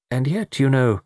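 lightly dreamy, trailing the thought. A narrator's reading. Mister Quin muses on the watch, mildly dreamy. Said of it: "said Mister Quin dreamily".

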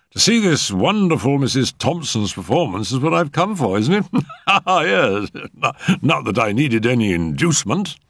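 high-pitched, chuckling compliment; jovial gallantry. Acted. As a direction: flatter the hostess, breaking into a laugh. Physician gallantly laughs to hostess.

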